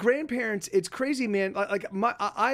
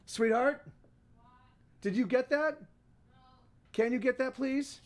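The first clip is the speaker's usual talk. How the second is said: higher-pitched, unhurried request off-mic; calling to partner; 6 semitones higher than usual. Real, not acted. Streamer asking partner to get the door, higher-pitched call. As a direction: call out in short, separate questions. Calls to partner about the doorbell.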